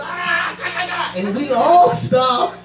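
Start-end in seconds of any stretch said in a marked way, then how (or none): 0.0-1.1 series of high mock fighting yelps; battle cries. Sal shows his street fight move, high battle yelps. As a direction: imitate odd martial-arts cries.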